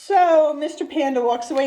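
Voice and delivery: ordinary delivery, nothing marked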